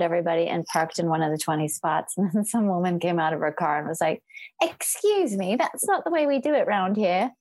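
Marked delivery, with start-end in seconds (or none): 4.6-7.3 high, prim British lady imitation; lightly voiced prim British lady; British accent. Imitating the British woman scolding her, prim British accent. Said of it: "a British lady".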